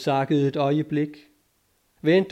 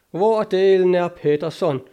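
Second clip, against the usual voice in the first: louder, higher, exasperated demand; irate exclamation, voice raised; angry outburst; 5 semitones higher, 6 dB louder than usual. A narrator's reading. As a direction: burst out, demanding to know where he is. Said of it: "udbrød O'Kelly og blev mørkerød".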